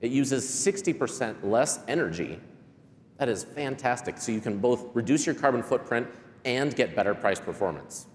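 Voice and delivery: ordinary talk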